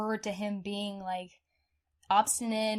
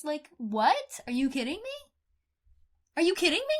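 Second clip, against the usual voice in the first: incredulous; 6 semitones higher, 3 dB louder than usual. Real, not acted. Outrage at slow search.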